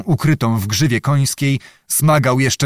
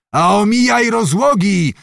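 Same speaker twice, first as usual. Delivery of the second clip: shouted after him; 7 semitones higher than usual. A narrator's reading. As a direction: call it after a departing rider, pitch raised. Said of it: "krzyknął mu na drogę Zagłoba".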